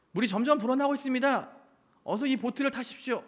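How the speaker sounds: high, urgent call from the rescue boat; rescuer calling out. Acted. Rescue crew calling up to the pastor on the roof, high urgent call.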